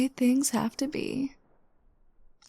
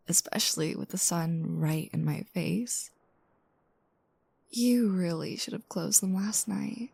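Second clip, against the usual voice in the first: sleepy morning whisper. Acted. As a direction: murmur it like you just woke up beside them.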